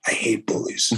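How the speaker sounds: firmer whisper. Real, not acted. Repeating to the host, a stronger whisper. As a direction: press the words out in a hard whisper.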